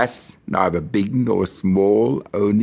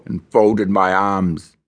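ordinary delivery, nothing marked

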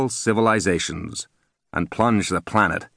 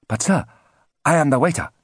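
higher, indignant waiter voice; protesting waiter; 9 semitones higher, faster than usual. Acted. Fake waiter protesting to the boy, lightly higher voice. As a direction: insist you're the waiter, pitched up.

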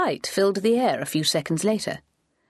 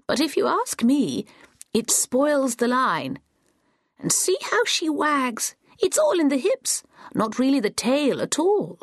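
put-on higher, slow old woman voice; a chatty old lady, pitched up; old granny voice; 8 semitones higher, slower than usual. A narrator's reading. Granny explaining how the dog wags, higher and slow.

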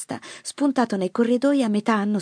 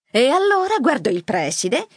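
higher, louder storytelling; animated mother; 7 semitones higher, 4 dB louder than usual. A narrator's reading. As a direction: launch into your anecdote with gusto. Mother animatedly tells an anecdote. (madre che racconta animatamente)

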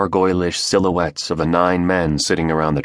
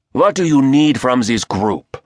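raspy voice; 7 semitones higher, 4 dB louder than usual. Acted. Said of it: "the raspy voice asked".

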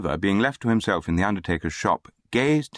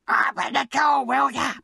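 very high-pitched demand through a door; impatient cry. A narrator's reading. Charity boy kicking at the shop door, impatient high cry. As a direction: cry out to be let in, pitched right up. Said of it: "cried a voice".

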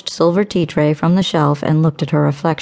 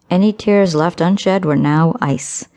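ordinary delivery

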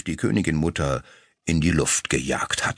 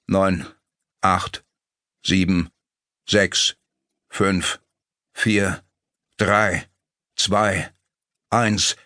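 counting aloud; much slower than usual. A narrator's reading. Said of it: "begann laut zu zählen".

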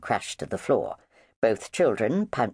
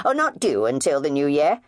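nonchalant; 5 semitones higher, 5 dB louder than usual. Acted. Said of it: "replied Missus Emery nonchalantly".

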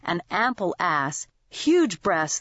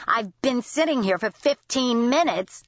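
pitched-up complaint, lightly voiced; irate customer; 4 semitones higher than usual. A narrator's reading. Customer complaining about the wait, pitched up. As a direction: complain with impatience.

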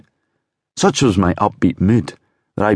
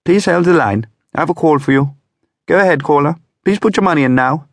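much higher, louder posh operator; formal switchboard operator; 10 semitones higher, 3 dB louder than usual. Acted. Operator connecting a caller, posh and high. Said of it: "an operator's voice".

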